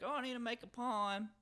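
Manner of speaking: put-on old lady voice.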